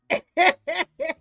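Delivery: big loud laugh bursting out; loud burst of laughter. Real, not acted. Laughing loudly at the game's joke.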